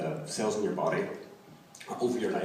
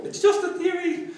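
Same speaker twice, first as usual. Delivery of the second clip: mock shout, much higher, retreating; 5 dB louder than usual. Evangelist scuttling away from the door, shouting it back. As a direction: yell it back over your shoulder while fleeing. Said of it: "still shouting".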